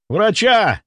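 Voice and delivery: high-pitched urgent yell; full-voiced yell. A narrator's reading. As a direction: yell for help at the top of your voice. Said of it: "заорал он во весь голос".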